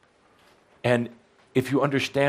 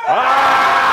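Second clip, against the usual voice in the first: huge, high, loud all-out scream; full scream; 14 semitones higher, 10 dB louder than usual. Real, not acted. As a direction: scream with everything you have.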